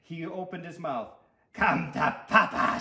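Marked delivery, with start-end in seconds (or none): 1.5-2.8 loud, slow character holler; gloating, drawn-out call; greedy shout. A narrator's reading. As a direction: holler it hungrily. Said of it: "shouted the tooth".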